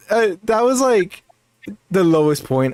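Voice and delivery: ordinary talk, nothing marked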